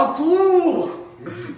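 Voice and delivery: high, loud triumphant cry; excited discovery. Acted. Cop finding a trail to his suspect, excited shout.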